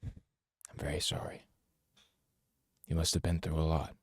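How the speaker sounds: soft whisper. Acted. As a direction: whisper it kindly. Consoling the survivor.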